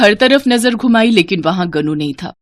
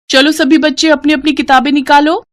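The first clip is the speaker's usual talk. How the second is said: higher, brisk classroom command; teacher voice; 8 semitones higher than usual. A narrator's reading. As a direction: call the class to order. Teacher आती हैं और पढ़ाने लगती हैं.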